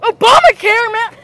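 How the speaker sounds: shouted with a rising end; sudden hammed-up yell; loud outburst. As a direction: blurt it out loudly. Random excited outburst.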